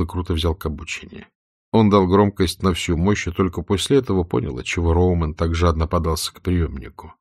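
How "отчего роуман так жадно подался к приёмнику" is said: ordinary delivery, nothing marked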